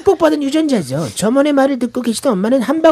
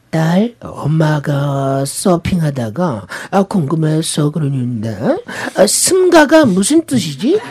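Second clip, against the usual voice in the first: mother voice; 7 semitones lower, slower than usual. Retold or imitated. Mom asking daughter slowly.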